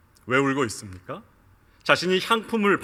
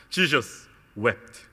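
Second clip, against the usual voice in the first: slow solemn reading; 5 semitones lower, 5 dB quieter, much slower than usual. Shown as a demonstration. Verse read slowly for impact.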